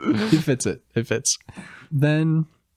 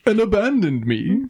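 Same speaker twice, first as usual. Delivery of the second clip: wounded bear; 9 semitones higher than usual. Retold or imitated. Acting Barry's grievance.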